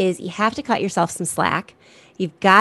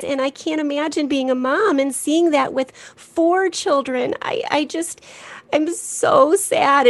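put-on high, slow, teary little girl's plea; voicing a weeping daughter; tearful child; 8 semitones higher than usual. Mother retelling her crying daughter's words. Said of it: "she came to me crying that day".

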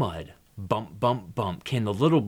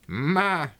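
higher, drawn-out goat bleat; goat's bleat; 8 semitones higher, 3 dB louder than usual. Acted. Goat answering Little Blue Truck, bleating.

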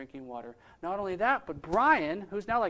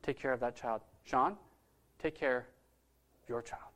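soft, lowered, lightly voiced divine prompting; a hushed, understated call, slowing at the end; God's voice, gently imagined; 6 semitones lower, 6 dB quieter, slower than usual. God telling the sponsor to care for the child, gently imagined. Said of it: "I felt like God saying".

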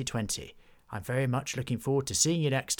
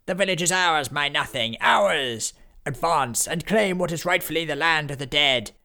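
menacing villain proclamation; 6 semitones higher, 6 dB louder than usual. Acted.

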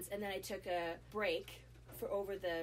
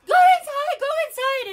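high, loud urgent shouting; 23 dB louder than usual. Retold or imitated. Neighbor woman ordering her indoors, high urgent shout. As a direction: yell at her to get inside now. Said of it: "she's like".

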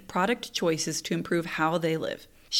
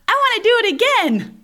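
put-on high, loud child's demand; eager kid; 8 dB louder than usual. Older child begging mom for another ride, eager. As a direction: imitate an excited kid piping up loudly.

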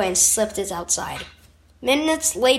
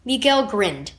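ordinary delivery, nothing marked

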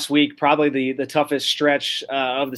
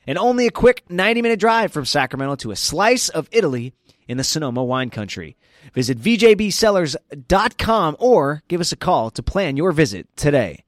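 energetic ad read; 2 semitones higher, 5 dB louder than usual. Acted. Host selling a winery to podcast listeners, loud upbeat ad read. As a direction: pitch the winery with big enthusiasm.

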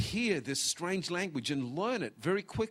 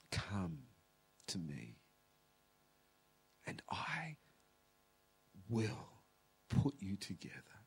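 very low, soft and halting; tender divine call; 9 semitones lower, 7 dB quieter than usual. Acted. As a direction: call gently, leaving space between phrases. Voices God's tender call.

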